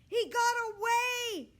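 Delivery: put-on high, loud girl's shriek of alarm. A narrator's reading. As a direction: shriek it in a panic. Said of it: "shrieked Zibby".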